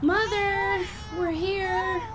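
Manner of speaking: high-pitched call to someone out of sight. Acted. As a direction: announce yourself through the house. Arriving, calling out to mother.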